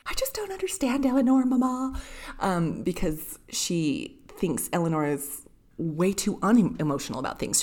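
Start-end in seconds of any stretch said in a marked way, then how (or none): 0.1-2.0 high-pitched mock teenage whine; complaining Marianne.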